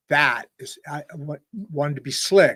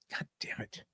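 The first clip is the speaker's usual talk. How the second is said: whispered curse; 20 dB quieter than usual. Real, not acted. Muttered curse after misspeaking.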